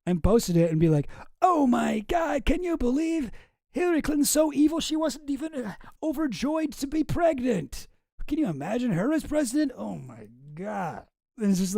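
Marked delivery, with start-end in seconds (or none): 1.4-11.0 mock shock. Streamer mimicking the person who posted the joke as real, mock horror. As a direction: imitate a scandalised poster, hammed-up.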